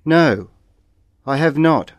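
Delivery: ordinary talk, nothing marked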